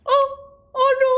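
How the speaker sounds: high startled wailing; frightened. Real, not acted. Scared player in the game, high alarmed cries. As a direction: react in high-pitched panic.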